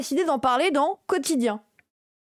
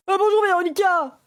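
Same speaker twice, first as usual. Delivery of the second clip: loud, high hammed-up greeting; a hammed-up imitation of a politician greeting loudly; mock Ruffin voice; 6 semitones higher, 5 dB louder than usual. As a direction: belt out "Ah, bonjour Véronica" as an over-cheery MP. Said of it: "Il a pas du tout cette voix".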